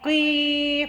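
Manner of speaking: "की" heavy and drawn out, not thin; heavy "qee". Shown as a demonstration. Teacher shows qaf read thick, heavy long "की". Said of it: "कॉफ को मोटा पढ़ना है".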